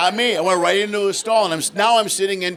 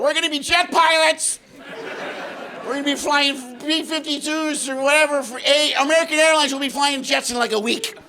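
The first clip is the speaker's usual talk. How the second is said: put-on excited young kids; 8 semitones higher than usual. Mimicking excited young pilots.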